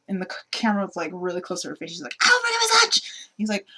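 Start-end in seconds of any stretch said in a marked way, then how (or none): 2.2-3.0 high, loud frantic outburst; hammed-up frantic insistence; mock freak-out. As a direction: imitate someone losing it, voice shooting up. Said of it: "she freaks out and slams her hands".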